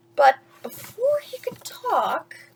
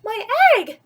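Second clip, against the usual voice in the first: higher, louder cry of joy; shouting; 7 semitones higher, 6 dB louder than usual. Acted. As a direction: call out the egg like a proud parent. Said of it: "shouted Horton".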